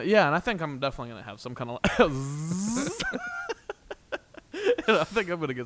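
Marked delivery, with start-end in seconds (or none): 1.8-4.8 long, high laugh; sudden laughing fit. Real, not acted. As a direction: burst into a high drawn-out laugh. Breaks up midway through sentence.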